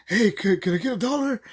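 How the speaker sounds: the same begging line to another car; imitating a begging lady. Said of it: "uses the same line".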